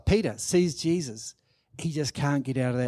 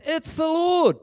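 sudden high excited cry; excited recognition; 12 semitones higher, 4 dB louder than usual. Retold or imitated. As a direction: call it out, amazed at who it is. John recognises Jesus from the boat.

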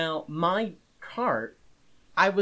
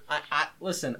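ordinary talk

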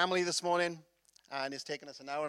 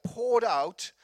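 "poured out" slow, each word lengthened; emphasis on "poured out"; 4 semitones higher than usual. Shown as a demonstration. Preacher asking church to ponder the word, "poured out" drawn out slowly. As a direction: linger on "poured out" so it sinks in. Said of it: "think of that word for a moment".

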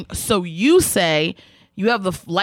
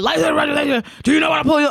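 high, rushed mock cop demand; aggressive officer; 6 semitones higher, much faster than usual. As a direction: imitate an officer firing off orders. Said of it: "she's so aggressive with me".

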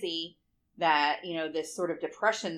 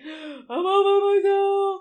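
high, loud, slow mock sob; mock sobbing; 12 semitones higher, 7 dB louder, much slower than usual. Mom mocking her own lonely crying, high and slow. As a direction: wail it like a lonely child. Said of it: "so sad".

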